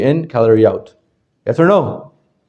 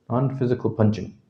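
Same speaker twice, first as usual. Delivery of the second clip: ordinary talk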